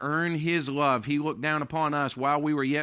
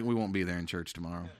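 sheepish mumble; 10 semitones lower, 9 dB quieter, faster than usual. Retold or imitated. Couple's sheepish reply, retold.

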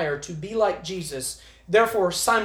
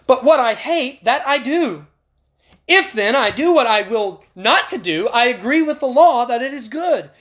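rising, louder impassioned reading of Paul; fervent scripture reading; 4 semitones higher, 4 dB louder than usual. Acted. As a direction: preach the verses with mounting fire. Preacher reading Romans at full force.

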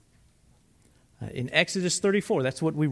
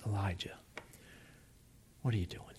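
much softer and lower, gentle hushed call; soft whisper of God; 8 semitones lower, 11 dB quieter, much faster than usual. Retold or imitated. Preacher voicing God gently whispering to the frightened Elijah, hushed. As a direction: barely breathe the question. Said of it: "the whisper of God".

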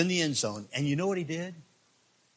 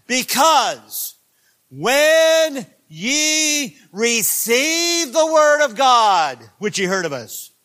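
slow, loud, high scripture read; preaching at full force; 8 semitones higher, 10 dB louder, much slower than usual. Acted. Preacher proclaiming scripture to his church, slow and loud. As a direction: stretch out and boom the words.

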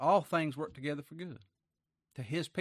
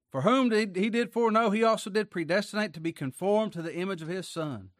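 loud, high-pitched preaching read; preacher sounding out scripture; 6 semitones higher, 5 dB louder than usual. Acted. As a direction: proclaim the verse with force, easing toward the end. Reads scripture aloud to congregation.

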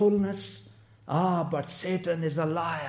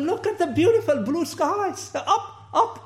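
excited call to look up; 11 semitones higher, 3 dB louder than usual. Retold or imitated. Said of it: "I said".

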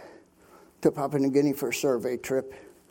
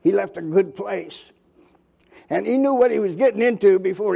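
rising, louder impassioned preaching, slowing on key lines; fervent preaching; 4 semitones higher, 6 dB louder than usual. Acted. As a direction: preach it with swelling conviction. Preaching climax about Christ's suffering.

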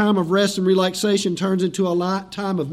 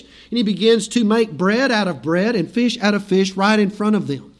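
normal talking voice

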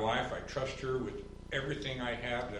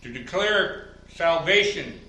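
much higher and louder, building fervor; preaching at full force; 5 semitones higher, 8 dB louder than usual. Acted. Pastor preaching hard against offered salvation, high and loud. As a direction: drive the point home from the pulpit.

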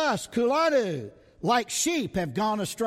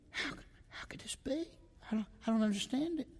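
unvoiced, much softer, hushed disbelief; bewildered whisper; 2 semitones higher, 9 dB quieter, much faster than usual. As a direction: breathe it out in wonder. Preacher voices bewilderment at healing.

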